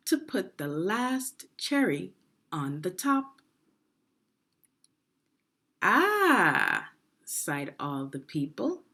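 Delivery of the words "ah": long, high, loud "Ah" of wonder; sigh of delight. Acted. Crowd admiring the dessert, awed sigh. People sighing at ice cream.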